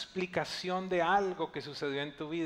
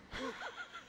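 high burst of laughter; 12 dB quieter than usual. Real, not acted. Preacher laughs at story.

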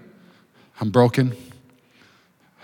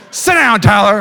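teacher's shout; 5 semitones higher, 5 dB louder, slower than usual. Retold or imitated. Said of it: "And the teacher's like".

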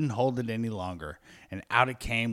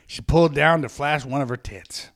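ordinary talk, nothing marked

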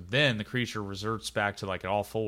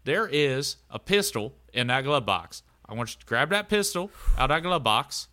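a touch higher, louder trucker voice; Doug giving orders; 6 semitones higher, 4 dB louder, faster than usual. Acted. Doug telling George to grab the pistol, a bit raised. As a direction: give firm quick orders to your buddy. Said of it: "and he's like".

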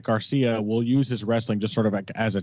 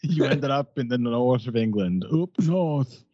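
put-on old northern English man voice; northern English accent. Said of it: "I can only do a northern accent".

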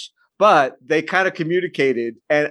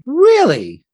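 high, loud astonished question; surprised; 11 semitones higher, 6 dB louder than usual. Real, not acted. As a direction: sound genuinely amazed. Surprised reaction.

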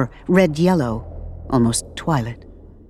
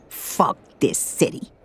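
put-on slow, raspy old woman's voice; a slow, rough old lady; 2 semitones lower, 4 dB quieter than usual. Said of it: "says the old lady".

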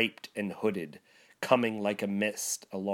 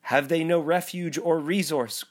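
Scrooge crying out; 6 semitones higher, 3 dB louder than usual. Acted. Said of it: "cried Scrooge".

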